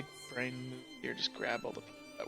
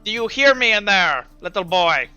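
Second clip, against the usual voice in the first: loud, higher call through to a prisoner; raised voice, talking down to a boy; plague doctor calling in; 4 semitones higher, 10 dB louder than usual. Acted. Plague doctor calls into the cell.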